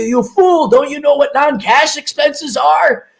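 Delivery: Ramsay-style yelling. Pretend. [name] yelling at the client like Gordon Ramsay, angry. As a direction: berate him like a TV chef. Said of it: "be like Gordon Ramsay and be yelling".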